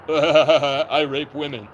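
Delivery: very slow words broken by a laugh; disbelieving laugh over the quote; laughing mock quote. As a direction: laugh while voicing the cop. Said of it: "And he goes".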